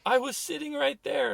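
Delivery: high-pitched imitation of an upset boy; retold tearful protest. Crying son answering his yelling father, tearful protest. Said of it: "he starts to cry".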